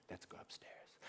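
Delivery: breathless conspiratorial whisper; boy whispering to Dad to keep it secret. Acted. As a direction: whisper so Mom can't hear.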